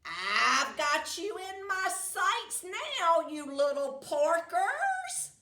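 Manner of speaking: high, loud cackling witch taunt. Acted. Witch taunting the pigs from overhead, cackling. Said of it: "she cackled".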